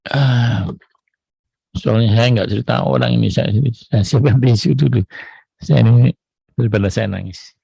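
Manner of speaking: real near-tears, voice straining; holding back tears. Real, not acted. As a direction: push the words out so you don't cry. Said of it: "saya gini aja mau nangis".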